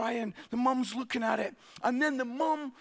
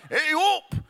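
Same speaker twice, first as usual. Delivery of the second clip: louder, higher Barnsley 'Hey, up!'; Yorkshire greeting, louder; 5 semitones higher, 5 dB louder than usual. Retold or imitated. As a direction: call out 'Hey, up!' in broad Yorkshire. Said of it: "Like, yeah".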